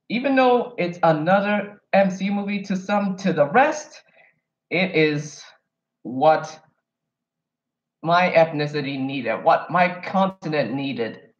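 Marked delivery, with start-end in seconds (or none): none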